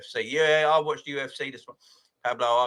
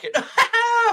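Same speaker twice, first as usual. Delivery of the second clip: high burst of laughter; gleeful cackle; big laugh; 12 dB louder than usual. Real, not acted. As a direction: laugh out loud at the score. Laughing at rival's loss.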